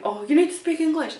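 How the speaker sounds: hypothetical rude shopper; mock rude demand. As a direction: ham up a rude customer. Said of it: "I'm not saying".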